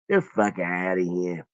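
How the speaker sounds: low, soft mock Italian accent. Streamer repeating his dismissal for laughs, mock Italian voice. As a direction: brush them off in a put-on Italian voice. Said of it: "My Italian voice".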